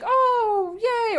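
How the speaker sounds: high, loud mock cheer of delight; theme park excitement. Shown as a demonstration. Demonstrating how Japanese sound when excited at a theme park. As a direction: squeal it like a thrilled park guest. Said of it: "excited for something at a theme park".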